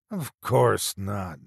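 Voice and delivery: slow, flustered sputter. A narrator's reading. Doctor A caught out about recording, sputtering. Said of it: "Doctor A sputtered".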